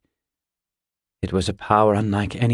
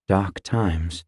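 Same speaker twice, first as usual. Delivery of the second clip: grim low voice; 3 semitones lower than usual. A narrator's reading. Cole's grim reply.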